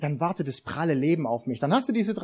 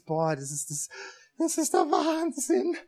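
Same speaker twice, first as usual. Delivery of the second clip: amazed; 8 semitones higher, 4 dB quieter than usual. Pretend. Imitating newlywed's amazed thought.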